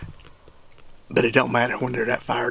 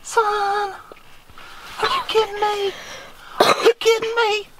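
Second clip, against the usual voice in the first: ecstatic shout. Real, not acted. Dad screaming with joy after his son's shot. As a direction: yell it out, overjoyed.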